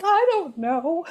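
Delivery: high, drawn-out mock-helpless reply; helpless protest. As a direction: protest cluelessly to the kids, stretched and high. Retold helpless reply to her kids.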